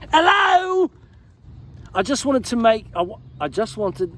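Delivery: high, hammed-up British accent; mock British accent. Shown as a demonstration. Vlogger reading the fan letter in fake British accent. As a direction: play a cheery Brit reading the letter. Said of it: "I should read it like this".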